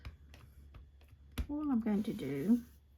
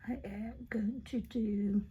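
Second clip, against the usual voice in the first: soft crafting whisper; 8 dB quieter than usual. Real, not acted. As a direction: hush it like an ASMR crafting video. Whispers while crafting on camera.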